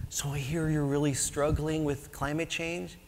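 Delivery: soft, gentle question; lightly voiced mild-mannered friend, softly; quietly concerned gentle voice. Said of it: "in his very gentle way".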